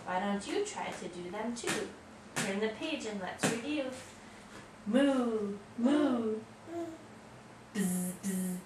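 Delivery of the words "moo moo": drawn-out mooing; cow moo. Acted. Child imitates animal sounds.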